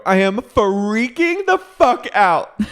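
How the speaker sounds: very high, loud drawn-out shriek; freaking out. Retold or imitated. Retelling a housewife overheard freaking out, hammed-up shriek. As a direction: mimic her meltdown at full pitch. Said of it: "You hear her go".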